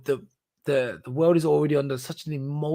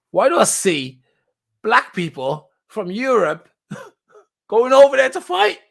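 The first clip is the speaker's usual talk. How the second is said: incredulous; 9 semitones higher, 8 dB louder than usual. Real, not acted. Incredulous about fighters.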